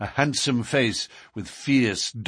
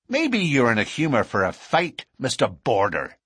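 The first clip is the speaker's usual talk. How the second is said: higher-pitched angry challenge; 3 semitones higher, 3 dB louder than usual. A narrator's reading. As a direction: throw down a challenge to a rival. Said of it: "eyes were blazing with anger".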